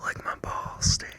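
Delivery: unvoiced joking whisper; whispered playful insult. Real, not acted. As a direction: breathe it out as a cheeky aside. Aside to friend, whispered.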